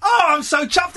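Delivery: high, loud delighted cry; thrilled shout. Real, not acted. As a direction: whoop with joy. Host thrilled at caller's tip.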